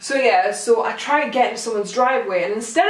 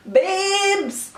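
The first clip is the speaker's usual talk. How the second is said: high, cheeky drawn-out babes; flirty; 10 semitones higher than usual. Retold or imitated. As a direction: sweet-talk the officer. Flirty reply to policewoman.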